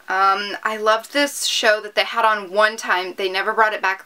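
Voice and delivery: ordinary talk, nothing marked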